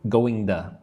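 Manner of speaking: "Going to" joined, ending in a weak "tuh"; words linked. Shown as a demonstration. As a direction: run "Going to" together as "going-tuh". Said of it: "Put those words together".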